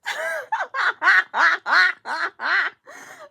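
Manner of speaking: long, high, loud cackling laugh; real uncontrolled laughing fit; loud burst of laughter. Real, not acted. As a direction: crack up helplessly for several seconds. Cracks up at the trailer.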